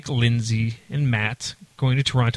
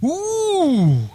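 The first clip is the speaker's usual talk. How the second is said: mock tipsy friend; much slower than usual. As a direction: mimic a buddy musing alone at the bar. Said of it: "he's sitting by himself going".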